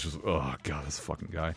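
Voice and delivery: a low, disgusted aside under your breath; disgusted groan. Retold or imitated. Room reacting to Hojo disgusted.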